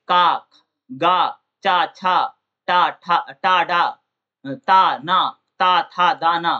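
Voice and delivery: का, गा, चा said one by one with little breath; slow syllable-by-syllable teacher demo of का, चा, टा; unaspirated consonant drills. Shown as a demonstration. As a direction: sound out का, टा, ता each crisp and separate, little air. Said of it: "अल्प प्राण, यानी जिस व्यंजन के उच्चारण में हवा कम निकलती है".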